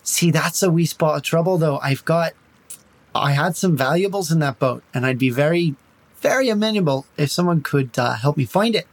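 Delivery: light Irish lilt, charming and evasive; Irish accent. Acted. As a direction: talk the bandits round in a mild Irish brogue. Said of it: "Now you're making me Irish".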